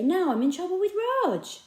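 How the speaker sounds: put-on boy worrying he is in trouble; worried boy. A narrator's reading. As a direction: fret like a caught kid. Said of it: "thought Joe".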